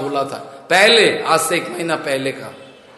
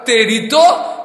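angry growl, a bit higher and louder; angry threat; 5 semitones higher, 5 dB louder, slower than usual. Acted. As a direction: threaten him with rising anger. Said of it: "तो गुस्सा हो गया".

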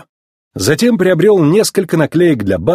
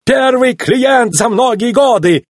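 high, slow, exultant cry; theatrical Italian shopkeeper's delight; exclaiming Italian; 11 semitones higher, slower than usual. A narrator's reading. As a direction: proclaim it in overjoyed high pitch. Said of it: "воскликнул Луиджи".

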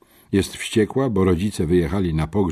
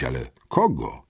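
ordinary delivery, nothing marked

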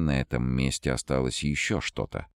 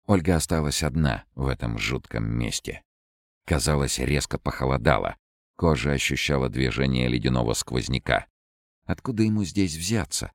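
ordinary delivery, nothing marked

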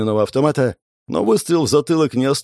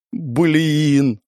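higher-pitched, drawn-out curse; cursing aloud; 4 semitones higher than usual. A narrator's reading. Napalm learning of the blunder, cursing aloud. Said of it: "в голос выругался Напалм".

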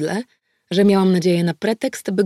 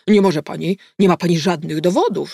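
louder, indignant man's protest; blurted protest; 5 dB louder than usual. Acted. Handcuffed suspect protests to detective, indignant and loud. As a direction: throw the objection at her. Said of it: "rzucił".

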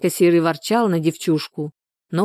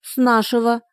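put-on high, matter-of-fact child voice; higher-pitched childlike reply; little girl; 5 semitones higher than usual. A narrator's reading. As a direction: state the obvious as a small child. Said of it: "пожала плечами Любаша".

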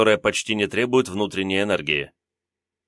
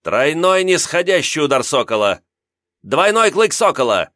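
loud, higher battle cry; shouting; 6 semitones higher, 4 dB louder than usual. Acted. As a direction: yell each attack name. Youth shouts technique names mid-fight.

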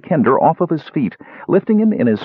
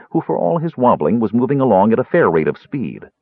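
ordinary delivery

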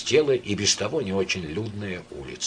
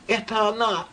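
high cry; 12 semitones higher than usual. A narrator's reading. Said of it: "вскричал багровый Лариосик".

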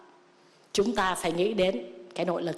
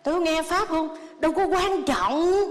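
hammed-up, higher, blissful layperson voice; mock follower; 7 semitones higher than usual. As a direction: play the smug devotee who skips the teaching. Monk mocks a contented follower.

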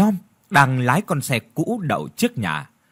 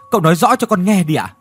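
urgent plea; 5 semitones higher, 5 dB louder, faster than usual. A narrator's reading. Brother urgently presses for answer.

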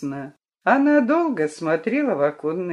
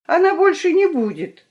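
put-on childish plea; 12 semitones higher, 4 dB louder than usual. Children plead for her.

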